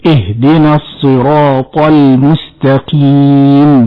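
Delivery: slow, measured Quran recitation. Acted. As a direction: recite it slowly and carefully. Host repeats the recitation.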